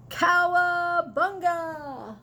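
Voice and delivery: slow, high whooping battle cry. Acted. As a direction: yell it as you charge in. Battle cry as trucks attack.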